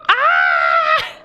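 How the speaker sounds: high, loud shriek of fright; startled scream. Real, not acted. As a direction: scream at the face in the trees. Startled by creepy face.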